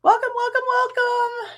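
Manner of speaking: cheerful high welcome; singsong welcome. Real, not acted. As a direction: greet them brightly. Greeting new member.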